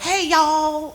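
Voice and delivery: high sung Southern "Hey, y'all"; hammed-up Southern greeting; Southern drawl. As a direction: drawl it out like you're singing. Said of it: "it sounds like a Southern drawl".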